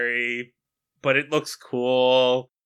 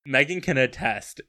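ordinary delivery

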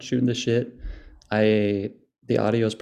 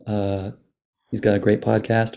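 ordinary delivery

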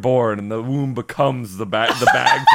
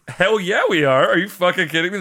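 enthusiastic retort; 5 semitones higher than usual. Retold or imitated. Host imitating die-hard Yeti hunters answering him, mock enthusiastic. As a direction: fire back like an eager believer. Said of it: "everyone's like".

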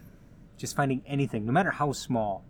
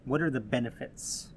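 ordinary talk, nothing marked

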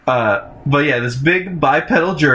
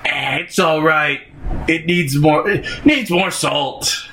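grumpy Eustace; 3 semitones higher, slower than usual. Retold or imitated. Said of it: "and he's like".

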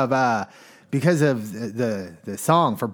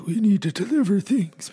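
higher, silly put-on bird voice; stork voice; 6 semitones higher than usual. Host imitating out-of-work storks pleading, silly stork voice. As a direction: squawk it lightly like a talking stork. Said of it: "that's how storks talk".

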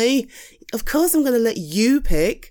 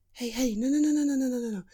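soothing hush; 4 semitones higher, 6 dB quieter, much faster than usual. Acted. Soothing shaking friend.

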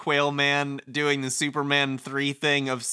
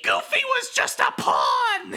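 squeaky villain reveal, slowing down; hammed-up cartoon cry in high falsetto; Mickey Mouse; 4 dB louder, slower than usual. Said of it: "final boss of every video game should be Mickey Mouse".

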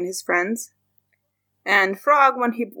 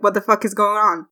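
normal talking voice

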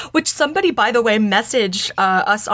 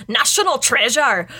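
"Treasure" in a badly faked Scottish accent; hammed-up bad Scots vowels on "National Treasure"; terrible Scottish accent; 3 semitones higher than usual. Shown as a demonstration. Host pitches film title to co-host in terrible Scottish accent. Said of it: "the worst accent I've ever done".

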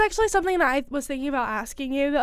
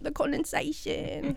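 slow, raised Aussie accent; Australian accent; 4 semitones higher, 4 dB quieter, slower than usual. Shown as a demonstration. Showing co-host more words, drawn-out Australian accent. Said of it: "just talk in an Australian accent".

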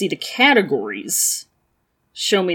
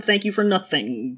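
usual speaking voice

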